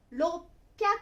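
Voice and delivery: 六百 read "rop-pyaku", stopped before the p; roppyaku. Shown as a demonstration. Teacher counting hundreds for learners, roppyaku with a held stop. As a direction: cut 六 to "rop", then "pyaku". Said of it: "発音は六百ですね".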